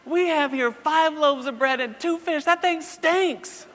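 high, loud, hammed-up wail; exasperated. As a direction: protest to heaven in a drawn-out, high-pitched cry. Acted exasperated complaint to the Lord.

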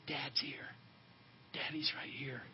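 hushed, low whisper. Retold or imitated. Father to his frightened little boy in the dark, whispering. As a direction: whisper it softly and reassuringly. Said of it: "I quickly whispered".

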